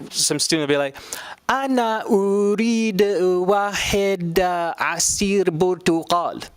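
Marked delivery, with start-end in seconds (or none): 1.5-6.5 put-on slow, higher, stiff Arabic with an English accent; foreign learner accent. Learner ordering juice, heavy foreign accent. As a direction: sound like a student reading Arabic. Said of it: "they would sound like this".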